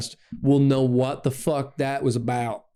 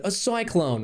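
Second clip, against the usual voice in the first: higher, softly Australian-sounding 'cyclone'; imitated Australian twang, pitched up; Australian accent; 5 semitones higher, 3 dB quieter than usual. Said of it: "She's Australian all of a sudden".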